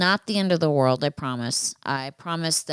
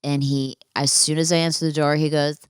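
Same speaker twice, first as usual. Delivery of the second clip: normal talking voice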